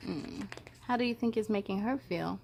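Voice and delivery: plain reading voice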